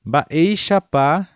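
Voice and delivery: normal talking voice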